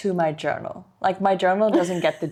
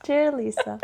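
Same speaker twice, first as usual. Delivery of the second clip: higher-pitched pretend diary voice; mock diary entry; 7 semitones higher than usual. Joking to co-host about her journal, mock diary entry. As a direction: act out writing to a diary, pitched up. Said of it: "Do you write Dear Diary?".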